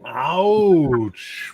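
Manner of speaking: ordinary talk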